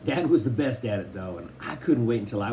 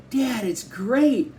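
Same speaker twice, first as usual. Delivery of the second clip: high, slow, gushing delight; a kid who can't believe his luck; thrilled; 12 semitones higher, 5 dB louder, much slower than usual. A narrator's reading. Kenneth peeking under the towel, thrilled high. Boy sees the record player.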